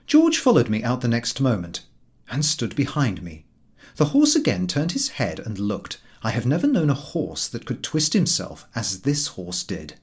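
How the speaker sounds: ordinary delivery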